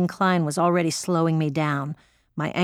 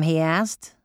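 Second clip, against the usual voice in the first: usual speaking voice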